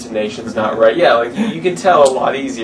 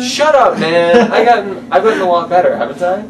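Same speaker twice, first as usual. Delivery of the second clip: higher and louder, playfully indignant; mock protest; 6 semitones higher, 5 dB louder than usual. Real, not acted. Protesting to his cohost after his old clip plays, mock indignant. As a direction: laugh off the embarrassment loudly.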